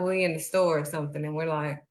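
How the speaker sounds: ordinary delivery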